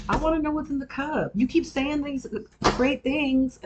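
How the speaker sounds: high-pitched, insistent demand; loud, high plea, slowing for emphasis; loud outburst. Real, not acted. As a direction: raise your voice way up and stretch the words in exasperation. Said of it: "That was loud".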